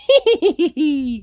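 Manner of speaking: put-on high, loud children's giggle; group giggle. A narrator's reading. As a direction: giggle together at the joke. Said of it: "Everyone giggled".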